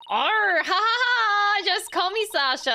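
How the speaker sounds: high, hearty pirate with acted laugh. Pirate client to the lawyers, jolly pirate. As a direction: roar a pirate laugh, then introduce yourself. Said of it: "before I speak pirate".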